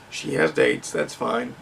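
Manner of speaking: dry whispered aside. Shown as a demonstration. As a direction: drop to a whisper, dismissive. Dismissive repeat of the point.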